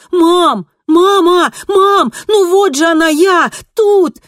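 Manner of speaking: very high, loud, desperate shouts; screaming. A narrator's reading. Kristina unseen by her mother, frantic screaming. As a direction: yell frantically to be noticed. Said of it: "закричала Кристина".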